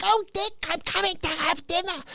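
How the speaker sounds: high sweet mock mother; mimicking his mother. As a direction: lightly voice the hopeful mom. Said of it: "She's always like".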